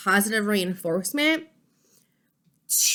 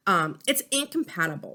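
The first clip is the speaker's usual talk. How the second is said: normal talking voice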